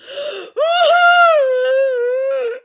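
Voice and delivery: long, high, loud mock wail; crying. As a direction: burst into an exaggerated drawn-out bawl. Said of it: "I start crying even more".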